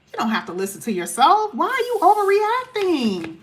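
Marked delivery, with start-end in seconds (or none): 1.5-3.4 higher, slow and condescending; mock dismissive voice. Reader mocking a partner who calls you overreacting, condescending. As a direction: imitate someone brushing off your feelings.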